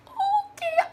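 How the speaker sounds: high, cracking, tearful okay; teary okay. Real, not acted. Sisters hearing they got in, high teary okay. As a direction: squeak it out, near tears.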